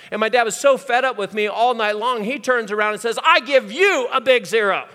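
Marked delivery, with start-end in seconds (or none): 3.2-4.9 fed-up dad. Retold or imitated. Fed-up dad answers son.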